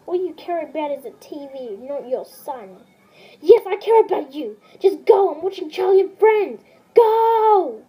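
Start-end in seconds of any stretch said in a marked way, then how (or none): 3.4-7.8 loud, impatient yelling, ending in a barked command; annoyed shout. Acted. Daddy yelling at Junior while watching TV, annoyed shout. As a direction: shout him out of the room, fed up. Daddy yells son away again.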